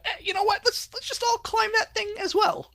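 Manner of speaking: put-on high, squeaky kid voice; chirpy children egging each other on; little girl voice. Voicing the little girls deciding.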